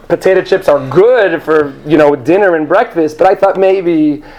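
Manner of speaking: ordinary talk, nothing marked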